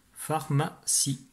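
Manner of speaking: slow, separated syllables. Shown as a demonstration. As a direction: recap the word piece by piece. Mot relu par syllabes. (mot relu lentement, syllabe par syllabe)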